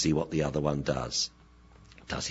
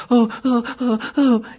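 high, squeaky mock whimpering; 4 dB louder than usual. Dog imitating its owner during a fight, squeaky whimper. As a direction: whimper helplessly in a tiny high voice. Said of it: "whimpered in a squeaky voice".

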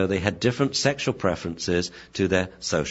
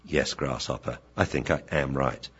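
low, soft, measured sensei; wise old master; 6 semitones lower, 7 dB quieter than usual. Acted. Wise Akita agreeing with pupil, low calm voice. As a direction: answer with quiet certainty. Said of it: "oh wise Akita".

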